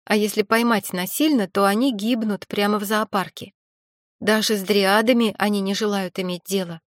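plain reading voice

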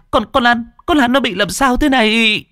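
high, stammering plea for help; frightened plea. Acted. Hằng begging neighbours what is wrong, frightened. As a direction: beg the neighbours in fear. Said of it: "Con Hằng kinh hãi".

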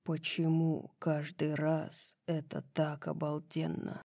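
low, soft intimate murmur; hushed lover's voice; whispered. A narrator's reading. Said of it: "прошептал он".

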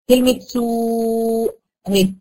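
سوء with the long waw held before the hamza; سوء بمد الواو; stretched madd. Shown as a demonstration. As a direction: slowly draw out the waw in سوء. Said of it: "حرف المد هنا حرف الواو".

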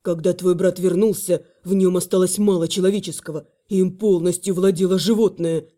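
low man's voice; low male voice. Acted. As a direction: speak as a grave companion. Male companion speaks.

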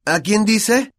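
speaking up. A narrator's reading. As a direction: ask it out loud across the class. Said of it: "preguntó Dean Thomas en voz alta".

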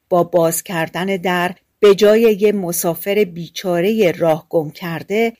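ordinary delivery

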